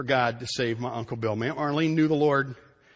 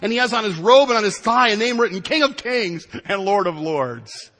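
loud, high, forceful scripture reading; preaching scripture; 8 semitones higher, 8 dB louder than usual. Acted. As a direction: proclaim the verse at full force, slowing on the last title. Reads scripture at full force.

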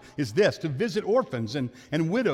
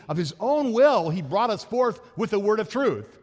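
louder, higher, proclaiming the verse; forceful scripture reading; 5 semitones higher, 4 dB louder than usual. Acted. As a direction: preach it out with conviction. Preacher quoting scripture with force.

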